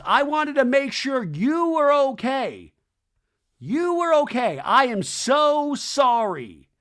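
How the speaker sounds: mock tearful apology. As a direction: draw out a fake apologetic whine. Mocking apology voice.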